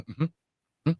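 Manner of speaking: soft, absent-minded murmured agreement; bored partner. Retold or imitated. Wife half-listening to his late-night rant, soft distracted murmur. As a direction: humour someone who isn't really listening. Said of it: "she's sitting there going".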